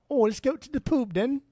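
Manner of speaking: high, loud mock British pub voice; mock accent. Teasing his cohost about pub trivia in a hammed-up British accent. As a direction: ham up a toff saying "poob". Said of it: "accent comes into play".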